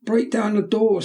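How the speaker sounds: put-on boy screaming, pitched far up. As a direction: scream it at the crowd. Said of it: "screamed the boy".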